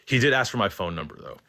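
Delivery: ordinary talk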